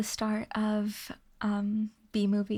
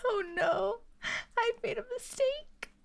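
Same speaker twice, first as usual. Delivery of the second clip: much higher, wheezy giggling words; laughing cry; 12 semitones higher than usual. Real, not acted. Streamer regretting a chat joke, laughing squeal. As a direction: squeal it out mid-laughing fit.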